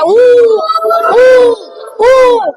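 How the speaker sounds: high, loud wailing ooh; loud mock baby wail. Shown as a demonstration. Teacher wails like a baby for long ऊ. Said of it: "अब वो जोर से रो रहा है".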